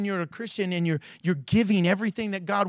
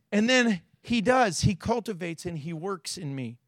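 ordinary talk

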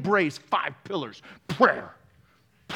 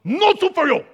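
Soup Nazi; 9 semitones higher, 4 dB louder than usual. Retold or imitated. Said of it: "Allah goes".

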